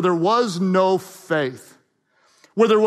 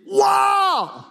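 amazed wow; 9 semitones higher than usual. Shown as a demonstration. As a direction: gasp it out in awe. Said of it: "Or would he be like".

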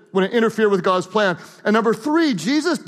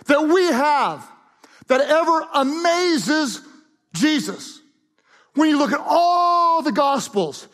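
preaching; 3 semitones higher than usual. Acted. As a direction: build to the key line. Preaching builds.

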